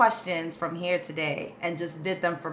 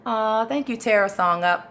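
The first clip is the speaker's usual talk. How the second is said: ordinary delivery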